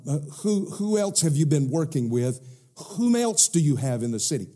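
normal talking voice

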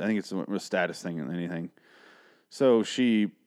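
ordinary talk, nothing marked

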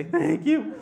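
high, loud weepy thank-you; acted crying gratitude; tearful thanks. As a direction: squeak out thanks through mock sobs. Sobbing man thanks the cashier.

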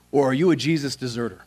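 ordinary talk

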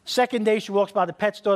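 ordinary talk, nothing marked

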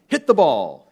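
loud, punchy read-aloud exclamation; exclamation with emphasis. Retold or imitated. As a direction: read it out as if coaxing a child to use emphasis. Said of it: "There's an exclamation point".

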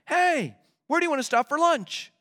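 high, eager, friendly question; hopeful and excited. Acted. Nudging a friend driving on a road trip, hopeful and excited. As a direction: perk up and ask eagerly. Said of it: "all hopeful and excited".

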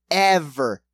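ordinary delivery

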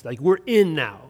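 usual speaking voice

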